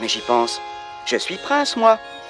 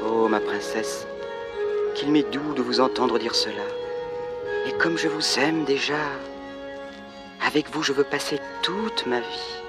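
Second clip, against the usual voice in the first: tender; 4 dB quieter than usual. Acted. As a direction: speak gently to your beloved. Prince declares love softly.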